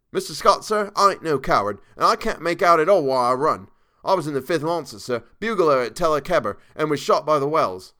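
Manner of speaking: put-on insistent Cockney old soldier; Cockney dialect. A narrator's reading. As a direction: defend your courage to your employer in Cockney. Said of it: "Thomas's Cockney dialect".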